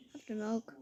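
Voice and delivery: quiet unvoiced whisper; hushed cashier line; whispered offer. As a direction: hand it over in a whisper. Cashier handing over milk.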